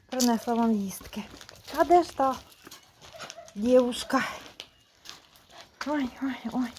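ordinary delivery

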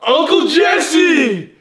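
sitcom shout. Acted. Calling out the nickname.

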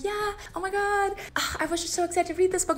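breathless fangirl delight; gushing fan. Retold or imitated. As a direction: squeal it like a starstruck reader. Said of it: "You're like".

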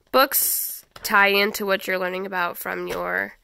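ordinary talk

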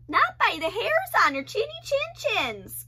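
high squeaky pig voices; crying pigs. Acted. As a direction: cry out defiantly. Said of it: "cried the pigs".

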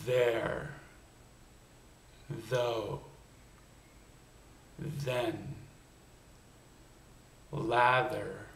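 "There", "Though", "Then", "Lather", "Weather", "Other", "Father" with a buzzing voiced th, tongue at the teeth. Shown as a demonstration. Coach modelling voiced TH words for learners, with the TH buzzing. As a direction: add voice to the th so it hums. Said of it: "let's do a few voiced T H sounds".

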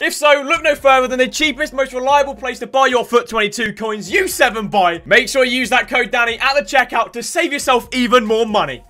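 loud, punchy promo delivery; hard-sell ad read. Acted. YouTuber plugging his coin sponsor, hard-sell ad read. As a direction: shout the pitch like a hard-sell commercial.

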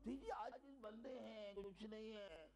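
mock-humble, slow and small; meek humble voice. Deniers' picture of a prophet, mock meek. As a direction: imitate a timid self-effacing man. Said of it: "جو نقشہ منکرین کھینچتے ہیں".

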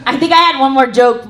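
usual speaking voice

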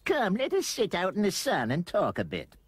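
put-on high-pitched old lady voice; fluting, high old aunt. A narrator's reading. Aunt Dorothy invites Roy to sit outside, old-lady voice.

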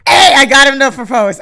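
loud, high-pitched burst of triumph; excited cheer. Real, not acted. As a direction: cheer a hard-won success out loud. Celebrates successful in-game proposal.

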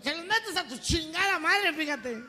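high, loud, drawn-out retort; squealing comic anger; indignant father. Acted. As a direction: throw the insult back, outraged. Father rejects boyfriend's offer.

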